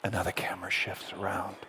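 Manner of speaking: soft, low, near-whispered telling; hushed narration. A narrator's reading. Preacher turning attention to grieving Job, hushed. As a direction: leave space after the tragedy.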